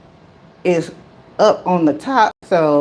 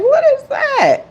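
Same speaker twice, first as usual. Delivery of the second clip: surprised. Retold or imitated. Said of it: "And I'm like".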